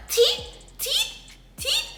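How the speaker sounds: put-on very high squeaky toddler cry of "teeth"; imitating toddler. Mom imitating son fighting toothbrushing, very high squeak. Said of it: "he always be like".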